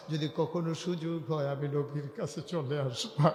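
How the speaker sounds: low, quiet intoned narration; soft sermon storytelling. Acted. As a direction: tell it gently in an even lilt. Preacher intones story tenderly and low.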